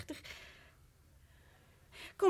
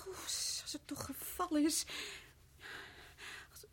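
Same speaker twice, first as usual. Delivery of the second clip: soft whisper of dread; whispered, terrified; frightened whisper; 13 dB quieter than usual. Acted. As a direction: breathe it out in fear. Frightened wife fears the statue fell.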